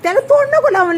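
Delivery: sharp warning. Real, not acted. Mother warning her toddler off the dirty thing, high sharp no. Warning child not to lick.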